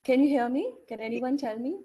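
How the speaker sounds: usual speaking voice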